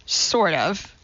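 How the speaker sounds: usual speaking voice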